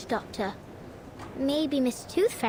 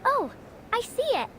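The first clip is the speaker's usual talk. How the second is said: much higher, delighted realisation; light up as you finally see it; sudden discovery; 8 semitones higher than usual. Acted. Girl spotting the wind, surprised and higher.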